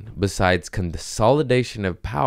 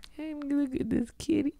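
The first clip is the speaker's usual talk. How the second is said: whisper to cat; 7 dB quieter than usual. Real, not acted. To the cat she's holding, a high soft whisper.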